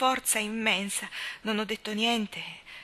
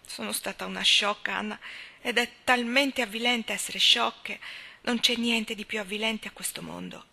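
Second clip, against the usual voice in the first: higher-pitched, distressed protest; anguished reluctance; 4 semitones higher, 3 dB louder than usual. Acted. Leslie cannot tell Anna her secret, distressed and ashamed. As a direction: struggle to confess and fail. Confessing shame to a friend.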